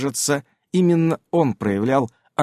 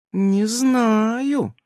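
mock sulk; 10 semitones higher than usual. A narrator's reading. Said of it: "притворно насупилась Эвелина".

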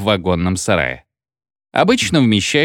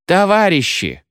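shouted appeal; 8 semitones higher than usual. A narrator's reading. Militia appealing to the rioting crowd, shouting. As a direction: call out high over the crowd. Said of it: "кричала милиция".

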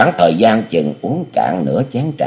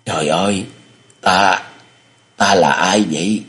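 ordinary delivery

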